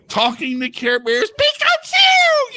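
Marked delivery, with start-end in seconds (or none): 1.3-2.6 high, drawn-out cartoon squeal; Pikachu cry. Sedated patient seeing Pokemon everywhere, high Pikachu shout.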